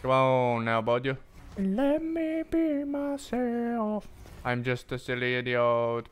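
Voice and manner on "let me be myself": high, slow wailing whine; hammed-up childish pleading; mock whiny plea. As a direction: whimper it like a sulky kid.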